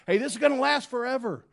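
high, loud and fast lament; loud, fast despairing cry; anguished outcry. Retold or imitated. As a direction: blurt it out in despair. Voicing David's complaint.

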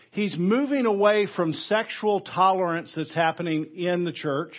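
normal talking voice

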